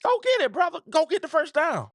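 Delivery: high, urging call to go get it; yelling at the screen. Retold or imitated. Fan yelling at the quarterback to get the first down. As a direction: urge him on like a fan shouting at the TV. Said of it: "And I was like".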